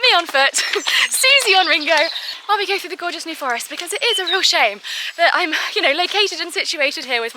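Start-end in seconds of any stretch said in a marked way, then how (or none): none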